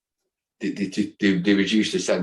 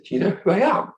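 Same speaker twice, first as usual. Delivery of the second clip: high, louder amazed question; excited; 12 semitones higher, 4 dB louder than usual. Retold or imitated. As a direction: blurt it out in disbelief. Astonished question.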